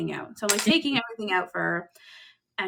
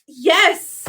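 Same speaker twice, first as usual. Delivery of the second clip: emphatic yes; 8 semitones higher, 11 dB louder than usual. Retold or imitated. Confirming to stunned Melanie.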